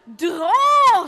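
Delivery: loud, excited hurrah; cheering shout. Acted. Disciples cheering at the full net, shouting loudly. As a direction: cheer and yell to pull. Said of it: "och ropade".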